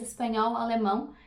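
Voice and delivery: ordinary talk, nothing marked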